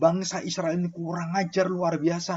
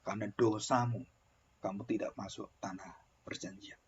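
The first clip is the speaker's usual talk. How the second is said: much softer, lower, grave pronouncement; voice of God; 7 semitones lower, 9 dB quieter than usual. Acted. God quietly tells Moses he cannot enter the land. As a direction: hand down the sentence in a hush. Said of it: "Dan Tuhan beritahu".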